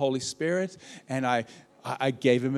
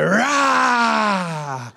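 louder, higher attack roar; shark roar; 7 semitones higher, 7 dB louder than usual. Acted. Preacher playing a shark attacking in conflict, roaring loud. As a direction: roar like a shark going for blood. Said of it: "They go".

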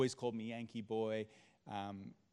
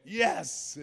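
excited cheer; 6 semitones higher, 9 dB louder than usual. Retold or imitated. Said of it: "I went".

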